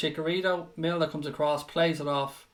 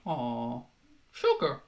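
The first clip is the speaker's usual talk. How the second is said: mock sympathy; 5 semitones lower, 5 dB quieter, slower than usual. Mocking offended viewers.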